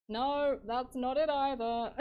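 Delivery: loud frustrated dismissal; exasperated. Real, not acted. Streamer rejecting another failed take, exasperated.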